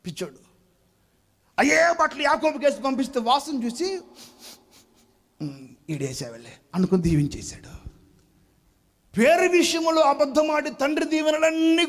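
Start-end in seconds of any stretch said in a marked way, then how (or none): 5.4-6.5 quiet satisfied murmur. Retold or imitated. Blind father sniffs, satisfied.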